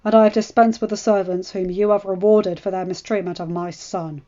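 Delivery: loud, cold commanding husband; stern lord. Acted. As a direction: hand down orders with no room for reply. Ned's stern orders to wife.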